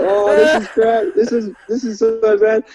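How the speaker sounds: high-pitched mock dismay; retold dismay. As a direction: replay your sinking feeling as the gig bombs. Retelling his dismay while bombing.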